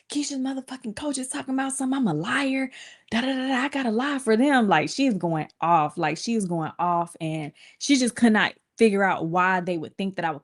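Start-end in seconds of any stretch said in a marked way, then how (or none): 0.1-4.7 mom ranting on phone. Retold or imitated. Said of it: "she calling everybody".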